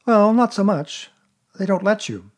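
put-on a small boy catching on; boyish Christopher Robin. A narrator's reading.

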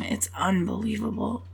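hushed whisper, very soft. Real, not acted. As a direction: whisper it close and gentle. Tender whispered reassurance.

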